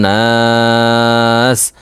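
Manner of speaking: নাস with the long aa held for several beats; drawn-out naas. Shown as a demonstration. Quran teacher stretching the vowel when pausing at the end. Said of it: "ওয়াকফ করলে".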